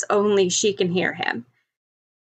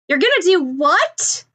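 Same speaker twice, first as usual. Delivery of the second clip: higher, alarmed and incredulous; stunned, can't believe what she heard; alarmed disbelief; 8 semitones higher than usual. Acted. Ochako looking up at Bakugo, alarmed disbelief.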